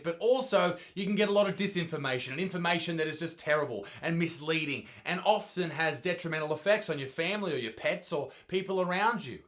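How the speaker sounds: usual speaking voice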